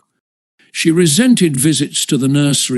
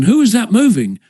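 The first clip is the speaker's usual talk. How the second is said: sharp question; 8 semitones higher than usual. A narrator's reading. Father interrupts calculation.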